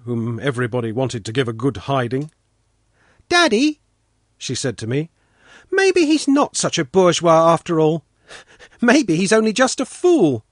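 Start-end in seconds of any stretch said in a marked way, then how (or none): 3.3-3.8 put-on high, loud childlike call; little daughter. A narrator's reading. Svetlana calling to her father, high child voice. Narrator plays small daughter. 5.7-10.4 put-on high, loud childlike voice; little daughter. A narrator's reading. Daughter telling her father about Sanka, high child voice. Narrator plays small daughter.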